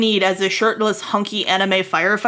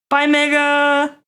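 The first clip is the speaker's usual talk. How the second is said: drawn-out goodbye; 6 semitones higher, 3 dB louder, much slower than usual. Real, not acted.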